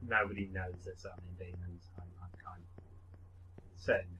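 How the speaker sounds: whispered aside; whispering. Real, not acted. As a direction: half-whisper it to yourself. Unvoiced aside.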